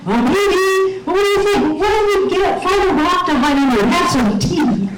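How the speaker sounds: high-pitched mock yelling; imitated screaming. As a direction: act out shouting over a gale. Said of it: "we're screaming at each other".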